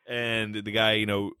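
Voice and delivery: normal talking voice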